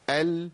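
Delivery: ال with a moderately held L; medium-length lam. Shown as a demonstration. Teacher shows the limit not to exceed, medium-length L in ال. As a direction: hold the L in ال briefly, no longer. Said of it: "بس ما تزيدش عن كده".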